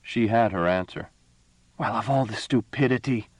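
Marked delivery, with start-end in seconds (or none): 1.8-3.3 sudden exasperated blurt; explosive outburst. A narrator's reading. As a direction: burst out in disbelief. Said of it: "said Imelda explosively".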